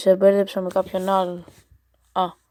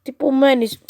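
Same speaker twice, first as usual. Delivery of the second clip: mock indignant; 5 semitones higher, 7 dB louder than usual. Reading bot's cheeky reply.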